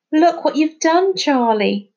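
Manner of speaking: high, loud upset outburst; boy blurts it out over broken shells; blurted out. A narrator's reading. Finn blurting at Charlie, upset and loud. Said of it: "he blurted out".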